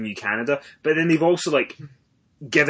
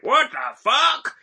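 cranky Gramps; 12 semitones higher, 6 dB louder, slower than usual. Retold or imitated. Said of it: "I'm Gramps at this point".

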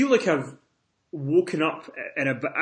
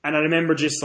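ordinary talk, nothing marked

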